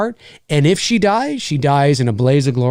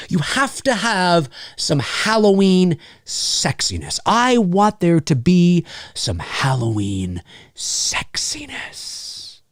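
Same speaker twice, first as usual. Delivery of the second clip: slow, drawn-out seductive tease sinking into a whisper; mock-sultry; slower than usual. Streamer hyping his Halloween chat, mock-sultry. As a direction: stretch it out, breathy-whispered on the last word.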